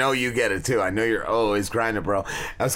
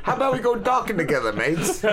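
mock British; 7 semitones higher than usual. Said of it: "Isn't that what they say over there?".

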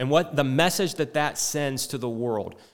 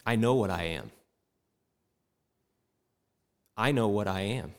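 quiet resolve; 5 semitones lower, 4 dB quieter than usual. Retold or imitated. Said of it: "she's saying".

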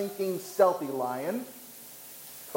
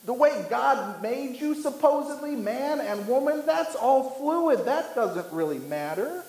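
mock roaring lion; 8 semitones higher than usual. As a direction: cry it out high, imitating the lion. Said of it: "The lion cries out and roars that".

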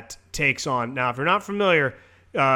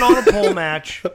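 laughing; 2 semitones higher, 7 dB louder than usual. Real, not acted. Pitching absurd match, cracking up.

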